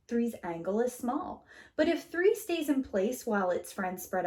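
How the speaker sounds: put-on gentle read-aloud to children; storybook reading. A narrator's reading. Teacher reading a picture book to kids, gentle and lively. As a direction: read it warmly, like story time.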